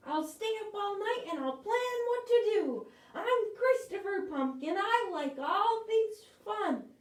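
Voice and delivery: high pumpkin voice, resolved; determined pumpkin. Acted. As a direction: vow to find a way. Chris's determined speech.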